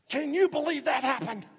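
high, loud, astonished cry; amazed exclamation. Shown as a demonstration. Preacher acting out everyday amazement to the congregation, high and loud. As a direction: gush it like unbelievable news. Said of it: "We say something similar".

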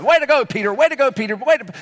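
high-five cheer from the disciples; celebrating. Acted. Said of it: "They're celebrating".

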